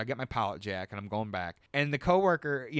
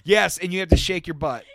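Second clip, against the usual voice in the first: loud laughing shout, higher; louder and higher, laughing; excited; 6 semitones higher, 10 dB louder than usual. Real, not acted. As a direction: gleefully yell the cheer instruction. Laughing, loud cheer instruction.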